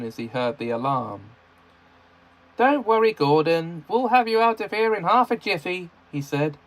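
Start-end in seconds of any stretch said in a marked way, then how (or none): 2.6-5.9 reassuring. A narrator's reading. Edward reassuring stuck friend.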